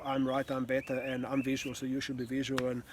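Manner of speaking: sarcastic parody of an egotistical speaker; mock ego voice. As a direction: lampoon a know-it-all demanding you match him. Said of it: "this is not a battle of ego".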